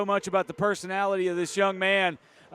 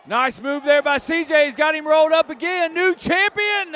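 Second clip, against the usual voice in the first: rising excited call; 8 semitones higher, 5 dB louder than usual. Real, not acted. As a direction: build to a near fall call. Commentator excited by near pin.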